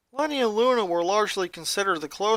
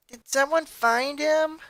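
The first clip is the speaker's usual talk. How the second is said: higher, slow character voice asking hopefully; hopeful question; 10 semitones higher than usual. Acted. Sister asks if anyone found their brother, hopeful. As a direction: ask with cautious hope. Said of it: "the blonde asked".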